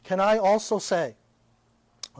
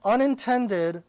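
unintended said slowly and pitched up; unintended, stretched slow and raised; stress on unintended; 3 semitones higher, slower than usual. Shown as a demonstration. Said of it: "underline that word".

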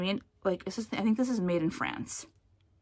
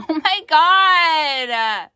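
long, very loud, high drawn-out squeal; delighted squeal; 8 semitones higher, 12 dB louder, much slower than usual. Real, not acted. Vlogger holds up a cute bib, delighted loud squeal. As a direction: gush over something adorable.

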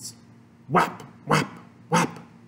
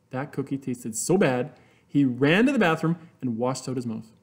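usual speaking voice

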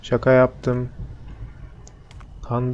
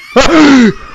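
frightened gasp; 12 semitones higher, 6 dB louder than usual. Real, not acted. Monster jumps out at him.

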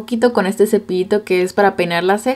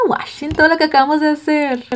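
excited show-off; 7 semitones higher than usual. Real, not acted. Showing off finished eye look.

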